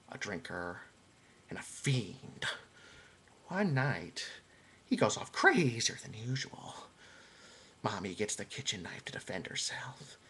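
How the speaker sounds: low, soft, slow menacing storyteller; hushed villain confession, fading to a whisper; Joker voice. Acted. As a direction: creep the tale out quietly. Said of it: "this last one I'm going to do".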